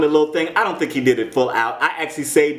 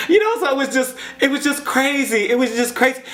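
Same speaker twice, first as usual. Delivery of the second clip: much higher, laughing through the words; 12 semitones higher than usual. Real, not acted. Vlogger laughing over the judges' silly British accents. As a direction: giggle it out high.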